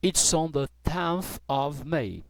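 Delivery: slow, careful British answer; slow model answer. Shown as a demonstration. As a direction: spell out "the" and "of" slowly. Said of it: "Pra falar".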